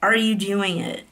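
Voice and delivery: ordinary delivery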